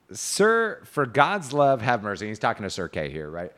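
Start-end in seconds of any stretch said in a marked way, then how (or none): 0.1-2.3 high, loud formal entreaty read aloud; declaimed knight's plea. A narrator's reading. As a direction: recite a chivalrous plea with storyteller's emphasis. Reads Gawain's plea.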